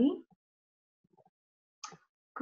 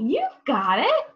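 usual speaking voice

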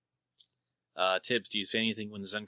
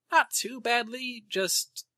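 high-pitched; 14 semitones higher, much slower than usual. Real, not acted. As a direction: voice jumps up high, slow. High excited reply.

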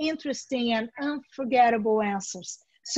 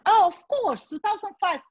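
flustered interviewer; 6 semitones higher than usual. Shown as a demonstration. Interviewer caught in error.

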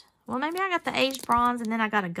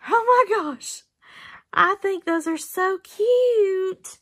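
high, loud, slow cooing over cute beads; gushing; 9 semitones higher, 7 dB louder, slower than usual. Real, not acted. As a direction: stretch out the delight.

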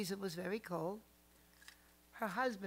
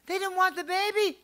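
grandmother's protest; 10 semitones higher, 11 dB louder than usual. Retold or imitated. Grandmother voiced in a story.

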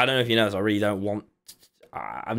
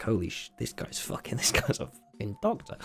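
hushed inner aside; 10 dB quieter than usual. Retold or imitated.

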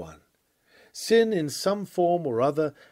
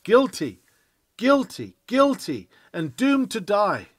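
loud, high chant of guilty; howling sins; 6 semitones higher, 6 dB louder than usual. Retold or imitated. A thousand sins howl at the preacher, loud and high. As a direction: howl the verdict over and over. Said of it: "howl out".

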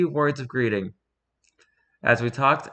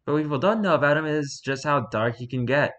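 ordinary delivery, nothing marked

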